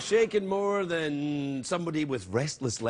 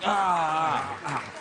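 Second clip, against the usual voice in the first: scratchy croak; much slower than usual. Retold or imitated. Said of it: "I was like".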